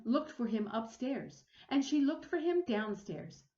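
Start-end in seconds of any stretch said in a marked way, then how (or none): none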